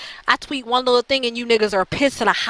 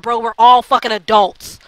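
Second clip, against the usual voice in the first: ordinary delivery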